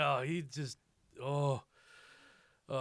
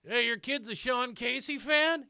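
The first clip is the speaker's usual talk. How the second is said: creepy stranger; 10 semitones higher, 8 dB louder than usual. Retold or imitated. Stranger at urinal, retold.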